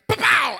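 very loud, high imitated smack; sudden mimicked bang; explosive impact noise. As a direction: blast out a collision sound. Imitates second possum hit.

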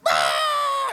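very high, loud yelp of panic; the speaker screaming as the first chute is cut away; retold terrified scream. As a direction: let out a sudden shriek of terror.

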